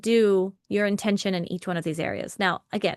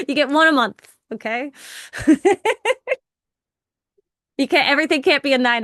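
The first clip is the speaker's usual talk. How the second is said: laughing; 5 semitones higher, 6 dB louder, faster than usual. Real, not acted. Joking limit between laughs.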